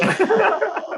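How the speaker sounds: short high burst of laughter; amused laugh. Real, not acted. Laughs at being asked.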